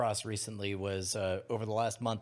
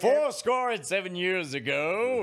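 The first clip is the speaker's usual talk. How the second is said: pompous orator; 6 semitones higher, 6 dB louder than usual. Pretend. Mock pompous speech.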